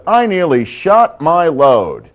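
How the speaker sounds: slow, high and loud, stretched out; milk the closing line; punchline finisher. Acted. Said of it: "I always ended with".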